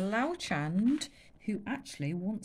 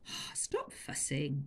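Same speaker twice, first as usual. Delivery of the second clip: lower, softer, slow brush-off; dismissive; 5 semitones lower, 6 dB quieter, slower than usual. Acted. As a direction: wave away the fuss. Chulak dismissing elephant.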